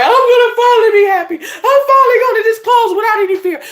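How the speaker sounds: high, loud tearful wail; mock happy crying. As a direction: act out bawling with joy and relief. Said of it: "you should be crying like".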